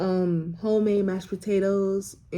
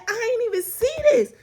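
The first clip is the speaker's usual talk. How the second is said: lifted, higher voice for the leasing agent; 12 semitones higher than usual. Retold or imitated. Quoting the leasing agent in a higher voice. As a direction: play the leasing agent, pitched up. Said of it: "She said".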